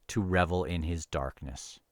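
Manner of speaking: ordinary talk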